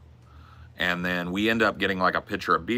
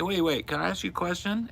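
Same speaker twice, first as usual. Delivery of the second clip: put-on eager drunk ask; 11 semitones higher than usual. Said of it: "I was like".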